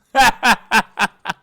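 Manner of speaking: high, loud laugh; high giggling laugh. Real, not acted. Reader laughing before the story starts, high laugh. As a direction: burst out in a quick high cackle.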